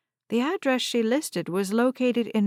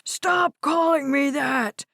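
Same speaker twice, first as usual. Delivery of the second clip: high, loud, slow angry shout; rumbling, shaking voice; 6 semitones higher, 3 dB louder, slower than usual. Acted. Old man yelling at his granddaughter. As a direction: shout it back at her, voice shaking. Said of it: "His voice rumbled and shook".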